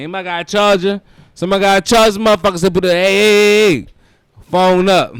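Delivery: loud, high hype-man shouting; shouting at the crowd to raise phones; mock concert hype.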